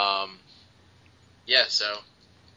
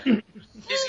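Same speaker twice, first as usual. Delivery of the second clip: ordinary talk, nothing marked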